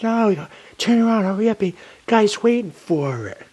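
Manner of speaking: high, loud hurried order; retelling the boss yelling to hurry; urgent shout. Retold or imitated. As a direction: bark it like a frantic dispatcher. Dispatcher urges him back.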